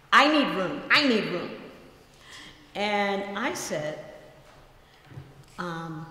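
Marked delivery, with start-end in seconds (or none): 0.1-1.5 louder, insistent woman's voice; demanding passenger. Retold or imitated. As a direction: voice the passenger demanding her space. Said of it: "and she said".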